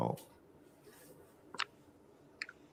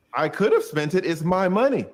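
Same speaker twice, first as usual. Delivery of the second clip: higher and louder, defiant; defiant outburst; 9 semitones higher, 6 dB louder than usual. Retold or imitated. Lawyer defending his own money to client, defiant outburst. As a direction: throw it back at him, indignant.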